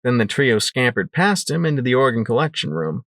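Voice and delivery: ordinary delivery, nothing marked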